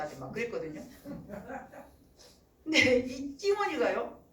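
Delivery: ordinary delivery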